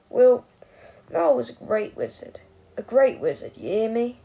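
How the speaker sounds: put-on loud, insistent drunk; drunken slur. A narrator's reading. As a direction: drunk old man insisting to the boy. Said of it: "he slurred".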